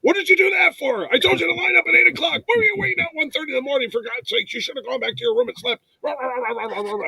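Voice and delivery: very high, rushed fatherly rant; hammed-up worried-dad scolding. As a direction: nag like an exasperated dad, fading into grumbling nonsense. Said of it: "I turned into a dad Mitch".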